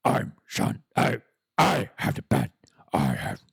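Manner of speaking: rough, whispery mock impression; forceful raspy character voice; Sean Connery. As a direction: do a rasping, muddled actor impression. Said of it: "was that Sean Connery that had the bat".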